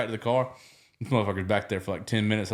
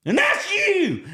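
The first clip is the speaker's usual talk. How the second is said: excited recognition; 4 dB louder, much slower than usual. Retold or imitated. Cop pointing at Courtney.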